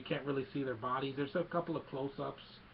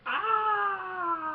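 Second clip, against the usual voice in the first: long, high, loud imitated scream; hammed-up wail of pain; mock agonized scream; 7 dB louder than usual. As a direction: scream like your hand was cut off. Acting out hand cut off.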